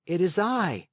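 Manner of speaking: answering up to the window; puppet boy. A narrator's reading. Pinocchio answering.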